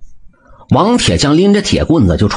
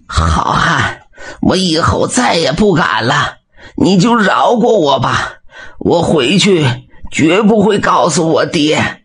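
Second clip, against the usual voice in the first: high, slow, whimpering plea for mercy; feeble begging; 6 semitones higher, slower than usual. A narrator's reading. Beaten bully feebly begs the blacksmith for mercy. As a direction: grovel with no strength left. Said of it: "有气无力地哀求道".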